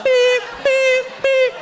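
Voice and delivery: high-pitched, even backup beeper; piercing monotone beeps, evenly spaced; reversing truck beeps. Retold or imitated. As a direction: imitate a truck's reversing alarm. Imitates a truck backing up.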